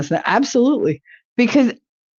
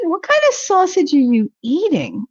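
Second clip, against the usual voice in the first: incredulous high; 9 semitones higher than usual. Real, not acted. Incredulous question.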